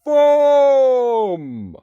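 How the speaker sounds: long, high, booming holler; loud giant's call. A narrator's reading. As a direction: bellow it out and hold the vowel. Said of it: "There came a loud".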